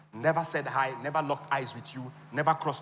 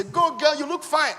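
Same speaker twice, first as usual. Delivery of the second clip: mock girlfriend hype; 12 semitones higher, 8 dB louder, slower than usual. Girlfriends hyping a friend.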